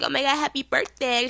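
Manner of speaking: high-pitched imitation of a young girl; excited freshman. Freshman coming up to wish happy birthday, high gushing. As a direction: gush like an excitable eighteen-year-old. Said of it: "this girl just turned eighteen".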